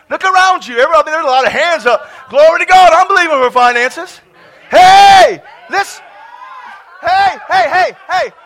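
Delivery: high, loud revival shouting; exuberant full-force preaching. Real, not acted. Preacher telling believers they just got it, exuberant shout.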